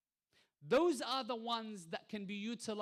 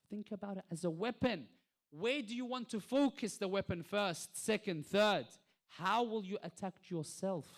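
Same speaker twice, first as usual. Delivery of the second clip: loud, raised sermon delivery; emphatic preaching; 3 semitones higher, 7 dB louder than usual. Real, not acted. Preacher challenging listeners to fight their own selves, emphatic preaching. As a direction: press each question home forcefully.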